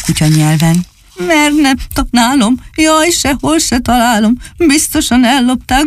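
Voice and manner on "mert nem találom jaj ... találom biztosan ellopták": high, whining puppy wail; wailing little dog. Acted. Csupi howls to his big friends, high whining wail. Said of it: "vonított újra".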